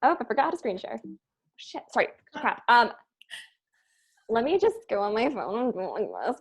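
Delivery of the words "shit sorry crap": ordinary talk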